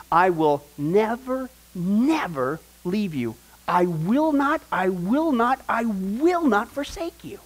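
slow, weighty stress on each "never" and "will not"; emphatic repetition. Retold or imitated. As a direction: hammer every "never" and "I will not", stretched out. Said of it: "God's being emphatic".

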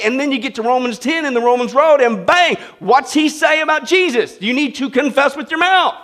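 loud, rising preacher's build-up; full-force preaching. Acted. Preacher rousing the congregation with the Romans road, loud.

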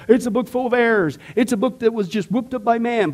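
a hint of the scoffer he used to be; imitating a skeptic. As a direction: toss it off like a cocky doubter. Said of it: "because they'll say".

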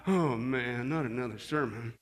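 low, soft groan of complaint; put-on weary moan; mock grumbling listener. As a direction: grumble it low, playing the bored churchgoer. Mimics a reluctant, grumbling listener.

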